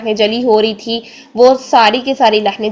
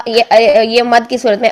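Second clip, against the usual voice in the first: ordinary talk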